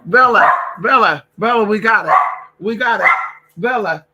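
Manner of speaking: loud call across the room; calling the dog loudly. Real, not acted. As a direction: shout the dog's name to stop her barking.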